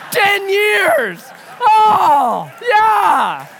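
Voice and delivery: very high, loud celebratory yell; triumphant shouting. Real, not acted. Preacher celebrating a jab held ten years, triumphant shout. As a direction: whoop it out in victory. Gleeful crowing over a joke.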